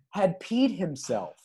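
ordinary talk

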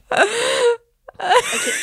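high teary laughter; crying laughing. Real, not acted. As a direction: laugh until you cry. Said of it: "Je pleure".

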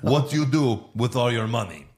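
lightly voiced gangster question; Russian guy. Russian gangster questioning the villain about money, lightly acted. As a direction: put on a hint of a Russian heavy. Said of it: "the Russian guy's like".

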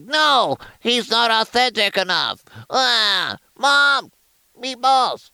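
high-pitched whining nerd impression; mock an outraged nerd in a high voice; comic book nerd. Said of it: "comic book nerds are gonna be like".

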